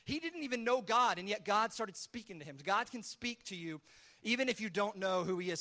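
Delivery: ordinary talk, nothing marked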